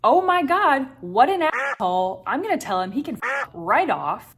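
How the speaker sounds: high, loud indignant outburst; outraged inner voice. Retold or imitated. Brain half fuming at the stranger, loud and high. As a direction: huff at the rude guy, fast and loud. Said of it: "half of your brain is like".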